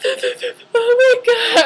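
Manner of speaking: high, loud, drawn-out retold sob; hammed-up tearful wail, slow and high; mock crying wail. Patient holding brother's hand for the shot, mock crying wail. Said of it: "I was, like, crying".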